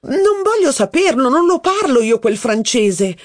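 high, hammed-up outrage; mock indignation. A narrator's reading. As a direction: pretend to be offended. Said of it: "finse di indignarsi la duchessa".

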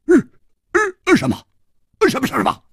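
stunned stammer. Acted. Hu Tiehua stammers in shock at Chu Liuxiang's guess. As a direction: choke on the news.

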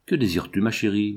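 plain reading voice

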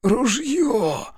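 high, wheezing cry through blood; choking on blood. A narrator's reading. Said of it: "хрипел, захлёбываясь кровью".

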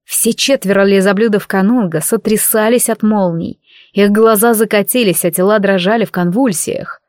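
usual speaking voice

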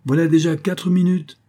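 high, loud warning; menacing countdown. A narrator's reading. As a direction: call out the time as a threat. Armed man counts down a threat.

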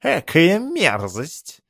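slow, disgusted high sneer; snooty cat. A narrator's reading. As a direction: drawl it out in disgust. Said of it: "Кот выразительно фыркнул".

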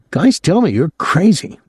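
put-on high, excitable young man's voice; a gawking kid, pitched high; young Claude, leering. A narrator's reading. Claude grumbling.

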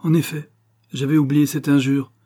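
wife rises proudly against an insult; proud and wounded. A narrator's reading. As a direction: stand tall and answer the insult. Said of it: "droite et fière".

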